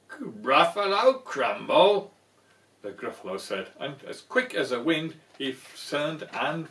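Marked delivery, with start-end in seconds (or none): 0.1-2.1 loud, slow horrified monster question; alarmed gasp. Acted. Gruffalo aghast at becoming crumble, loud and slow. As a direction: recoil at the idea of being eaten.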